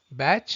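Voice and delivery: Bach said as "batch", rhyming with match; correct English pronunciation. Shown as a demonstration. Narrator restating the right name for viewers, Bach pronounced like "batch". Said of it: "rhymes with match or catch".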